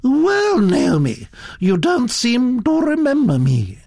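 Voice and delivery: high, swooping grande dame greeting; lofty reproach from a Duchess; imperious Duchess. Acted. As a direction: accost her grandly in a high voice. Duchess accosts the girl.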